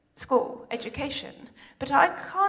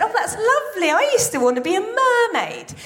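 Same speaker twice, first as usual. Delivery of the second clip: higher, sugary patronising tone; condescending advisor; 7 semitones higher than usual. Retold or imitated. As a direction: humour the kid with fake sweetness. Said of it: "And she said".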